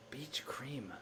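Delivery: unvoiced whisper of the answer. Shown as a demonstration. Solver whispering the answer to herself, whispered. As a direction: breathe the words out with no voice. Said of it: "repeats it plainly right after".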